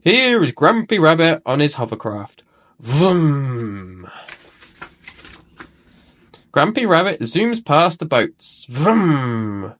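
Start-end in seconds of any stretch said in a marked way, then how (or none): 2.8-4.1 a droning hovercraft vroom; playful engine noise for a hovercraft. A narrator's reading. Hovercraft zooming past. 8.7-9.7 a droning hovercraft vroom; playful engine noise for a hovercraft. A narrator's reading. Hovercraft zooms past boats.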